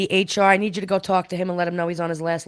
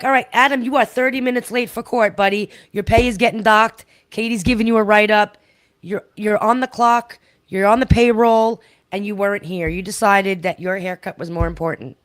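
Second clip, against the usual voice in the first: scolding boss; 5 semitones higher, 6 dB louder than usual. Retold or imitated. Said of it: "Look, he's like".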